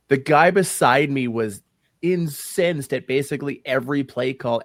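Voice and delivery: ordinary talk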